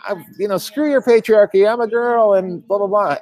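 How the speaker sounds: high, loud mock girl's voice, defiant; hammed-up cartoon girl; Lisa voice. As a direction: squeak it out like an indignant little girl. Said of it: "she goes".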